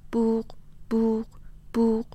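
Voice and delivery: high, level, monotone beeps; imitated scanner beep. A narrator's reading. Goods passing the checkout scanner one by one, imitated beeping.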